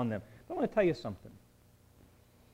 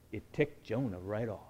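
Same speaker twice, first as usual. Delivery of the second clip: normal talking voice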